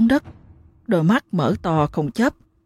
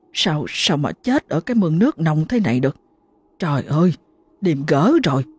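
plain reading voice

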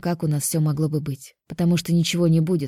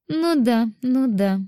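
a playful "sure, sure" with a higher voice; sly, knowing smile; 7 semitones higher, much slower than usual. A narrator's reading. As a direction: tease her with a knowing smile. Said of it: "С хитрой улыбочкой".

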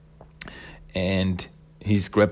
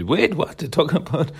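high-pitched retold bafflement; lightly acted incredulous question; mock disbelief; 7 semitones higher than usual. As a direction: act taken aback, voice jumping up. [name] reacts to being teased.

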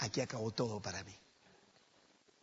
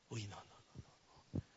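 near-whispered dismay; hushed pained no; 3 semitones lower, 14 dB quieter than usual. Acted. Preacher reacting to Peter's guilt, hushed. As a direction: breathe out the dismay softly.

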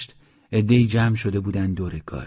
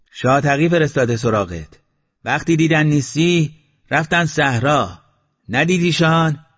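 raised, higher voice carrying down from above; calling down; 6 semitones higher, 6 dB louder than usual. A narrator's reading. Mashti Baba calls down to Eslam, raised voice. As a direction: call the news down to him. Old man calling down from roof.

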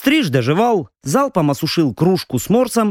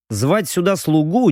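plain reading voice

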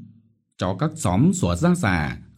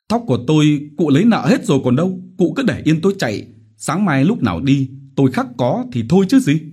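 fast, higher, stubborn answer; defiant retort; 8 semitones higher, 5 dB louder, faster than usual. Acted. Quý defying the village chief, fast stubborn retort. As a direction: talk back without backing down.

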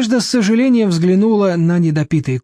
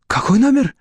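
startled high question; surprised; 7 semitones higher than usual. A narrator's reading. Cornered deputy director surprised by Nadezhda's question. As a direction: ask back in surprise. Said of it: "удивлённо переспросил".

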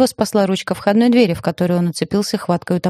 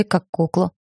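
usual speaking voice

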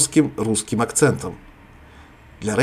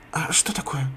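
whisper; 5 semitones higher, 6 dB quieter than usual. Acted. Woken Sara asks sleepily.

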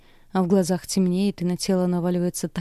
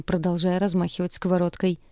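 ordinary delivery

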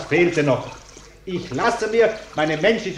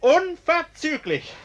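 indignant; 11 semitones higher, slower than usual. Acted. Repeats threat to throw them out.

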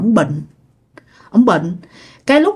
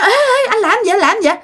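very high-pitched alarmed calls; acted grandmother in a fluster; frantic stopping; 11 semitones higher than usual. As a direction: cry out to hold him back. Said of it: "bà ngoại của bạn mới cản lại".

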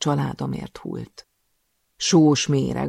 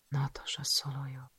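whispered, barely audible; stunned whisper; 12 dB quieter than usual. Acted. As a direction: breathe the name to yourself in shock. She repeats her own name. (döbbent suttogás)

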